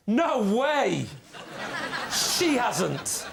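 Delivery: high-pitched mock disbelief; feigned amazement. Panelist fakes good news by phone, feigned amazement. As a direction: act astonished on a fake phone call.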